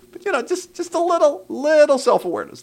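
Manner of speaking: very high-pitched, coaxing plea; gentle chiding. Real, not acted. Host asks a viewer for self-awareness, high coaxing voice. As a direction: soften the criticism with a squeezed-up high pitch.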